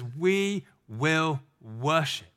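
very slow, We leaned on; slow emphatic quote. Acted. Preacher slowly repeating Abraham's answer under pressure. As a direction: let We will worship land slowly.

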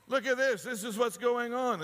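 mocking an eager social media poster; excited sharer. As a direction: hype the fake story. Imitating excited online sharer.

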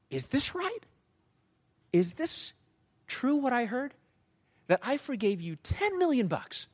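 high-pitched, acted disbelief; incredulous questioning. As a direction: question it like a king who can't believe the report. King confronts servant over report.

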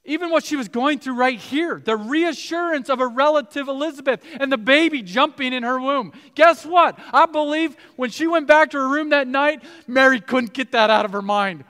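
high, loud, driving sermon voice; rising fervent preacher's call; full-force preaching. Acted. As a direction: preach it to the back row.

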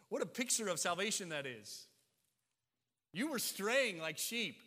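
loud, high, impassioned preaching; full-force pulpit preaching; fervent preaching. Acted. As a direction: preach it with rising fire to the congregation. Preaching builds over the flock image.

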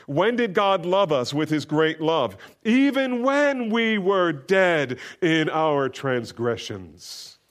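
fervent pulpit recital of Romans; scripture reading. Acted. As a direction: let the verse swell and ring out slowly. Reads scripture aloud.

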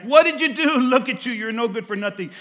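mocking scream. Pastor voicing failures taunting the listener, mocking yell. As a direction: sneer and yell like your failures mocking you. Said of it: "they scream at you like".

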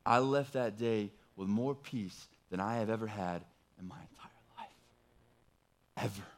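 hushed, intimate whisper; whispered confession. Real, not acted. Telling the congregation about his peace, whispered. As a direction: lean in and breathe it to the listeners. Quiet climax of the testimony.

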